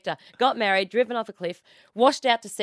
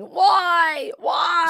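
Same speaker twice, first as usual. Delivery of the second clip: high, loud hammed-up wail; mock anguished cry; 10 semitones higher, 5 dB louder than usual. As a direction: wail it like a melodramatic soap groom. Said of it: "he was standing on the cliff going".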